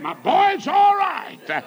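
high, loud, drawn-out holler; retold jubilant church shout; joyful shout. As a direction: shout the good news, stretching it out. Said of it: "I'm shouting because I used".

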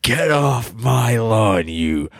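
slow, low growling yell; cranky old man. Acted. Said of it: "my famous character".

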